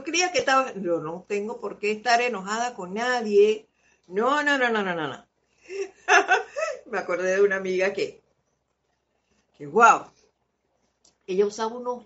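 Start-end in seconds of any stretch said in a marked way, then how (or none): 5.7-6.8 high burst of real laughter; a quick high giggle; bright laugh. Real, not acted. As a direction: laugh out at the memory. Laughs at own memory.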